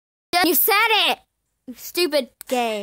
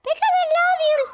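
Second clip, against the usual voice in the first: much higher, sugary pet voice; high cooing affection; 13 semitones higher than usual. Pretend. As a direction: coo it way up high. Making up after the scolding.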